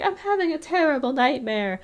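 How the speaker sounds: high-pitched put-on cartoon voice; Muriel. Muriel complaining of a nightmare during her rescue, high put-on voice. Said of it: "and Muriel's like".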